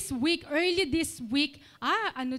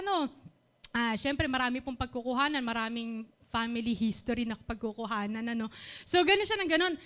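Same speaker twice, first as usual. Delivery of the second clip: usual speaking voice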